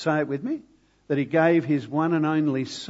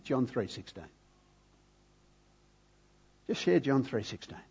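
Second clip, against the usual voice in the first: quiet prompting; 7 dB quieter than usual. Retold or imitated. Preacher retelling the Lord's prompt to him, hushed. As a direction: voice a hushed, simple instruction.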